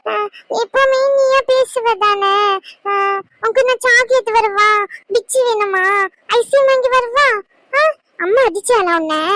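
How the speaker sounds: put-on babyish, tender questions; cooing baby talk. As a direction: soothe a poorly child in sing-song baby talk. Coaxing a sick child with treats.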